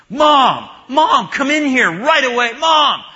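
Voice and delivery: put-on high, loud child's panicked yell for mom; child's scream. Little boy screams for his mom from the potty, loud and high. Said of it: "screamed out really loud".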